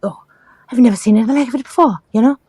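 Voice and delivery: put-on high, astonished nurse voice, no Irish accent; shocked midwife. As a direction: pitch up as the baffled visiting midwife. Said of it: "She was Irish. I could do her accent, but I won't".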